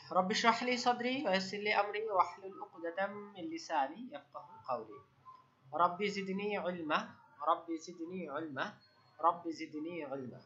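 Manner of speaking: prayer recitation. Real, not acted. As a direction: recite softly in drawn-out Arabic phrases. Recites opening supplication.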